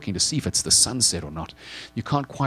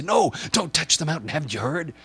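acted alarm, rising high at the end; urgent warning; 8 semitones higher than usual. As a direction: warn them off, growing more frantic. Acted warning holding listener back.

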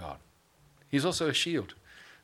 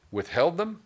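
incredulous question; 8 semitones higher, 5 dB louder than usual. Acted. Preacher mocks a listener's complaint.

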